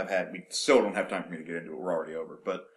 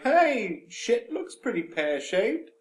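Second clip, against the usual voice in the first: voiced sister; 12 semitones higher, 4 dB louder, much slower than usual. Voicing Bethany in retold scene.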